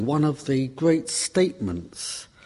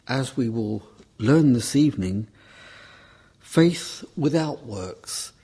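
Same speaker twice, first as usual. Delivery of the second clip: ordinary talk, nothing marked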